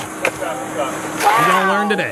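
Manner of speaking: slow, drawn-out taunt; gloating. Real, not acted. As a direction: savour every word slowly. Gloating at an arrest.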